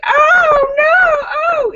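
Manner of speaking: high, loud mock horror; family's outcry. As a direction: wail it like a scandalized family. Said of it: "you can hear the family going".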